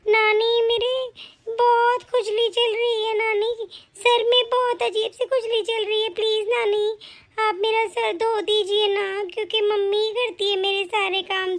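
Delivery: put-on high, slow little girl complaining; a small girl asking grandma for help, slowly; childlike whining. Granddaughter asking her grandmother to wash her hair, high and childlike.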